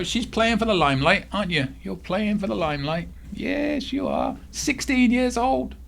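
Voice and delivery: high cooing voice to the cat; affectionate high pet voice; pet talk. Real, not acted.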